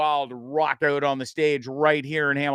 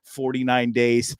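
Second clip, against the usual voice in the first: normal talking voice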